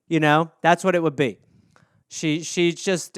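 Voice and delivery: usual speaking voice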